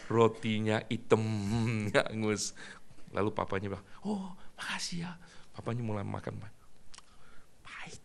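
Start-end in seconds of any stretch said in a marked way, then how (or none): 4.1-5.2 whispered thanks. Acted. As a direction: whisper it gently to the child. Father politely thanks child.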